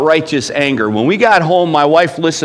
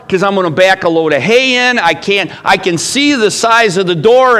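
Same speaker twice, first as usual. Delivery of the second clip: put-on warning the boys; 6 semitones higher, 4 dB louder than usual. Father warning his boys off the driveway, loud. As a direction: call it out across the farm. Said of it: "And I would say to them".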